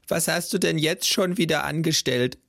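high grandma voice, scolding. Acted. Grandma scolding grandpa, high voice. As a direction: ask what he's done now. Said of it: "fragte die Oma".

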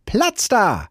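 put-on a high, loud shout of a boy pushing through; high loud boyish shout. A narrator's reading. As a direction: clear the way with a bratty yell. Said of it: "schrie Häwelmann".

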